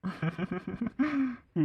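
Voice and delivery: high, rippling giggle; laugh. Acted. Teased over wishing to remarry, a long high giggle.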